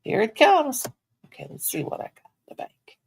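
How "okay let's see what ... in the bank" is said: soft whisper while checking the bank; quiet muttering whisper; whispered to herself. Real, not acted. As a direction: murmur it under breath.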